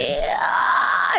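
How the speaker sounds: low creak opening into a scream; fry scream. Shown as a demonstration. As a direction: demonstrate a metal scream from vocal fry. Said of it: "how do you go from there to a scream".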